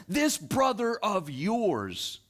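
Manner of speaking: put-on father correcting the older son, deliberate; pointed correction. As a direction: stretch out this brother of yours to make the point.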